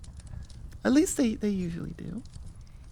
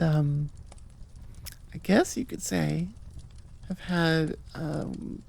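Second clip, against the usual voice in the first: ordinary talk, nothing marked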